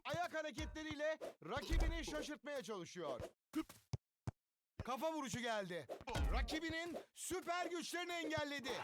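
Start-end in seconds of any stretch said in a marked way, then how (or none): none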